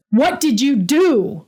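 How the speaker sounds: betrayed designer yelling at her boss, voice cracking. Acted. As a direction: yell it as the voice cracks. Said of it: "I yelled, my voice cracking".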